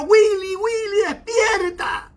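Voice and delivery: high, drawn-out shout of a name; urgent wake-up call. Retold or imitated. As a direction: call out to rouse a sleeper. Acts out waking sleeping cousin.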